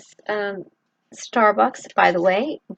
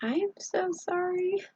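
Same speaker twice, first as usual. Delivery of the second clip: tearful, choked apology, softer; real tears breaking through the words; breaking down while apologizing to viewers; crying; 10 semitones higher, 5 dB quieter than usual. Real, not acted. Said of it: "I don't know why I cry".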